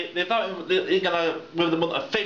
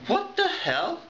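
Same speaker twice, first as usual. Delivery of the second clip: high, sharp disbelief; exasperated what the hell; incredulous outburst; 11 semitones higher, much slower than usual. Real, not acted. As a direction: throw the line up in outrage.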